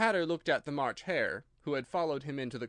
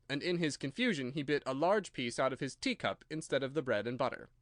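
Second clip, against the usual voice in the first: plain reading voice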